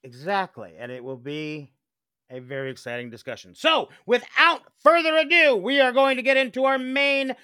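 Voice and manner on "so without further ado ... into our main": raised, projected podcast intro; show announcer. Pretend. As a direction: announce the main event, then drop for the title. Announces the main topic.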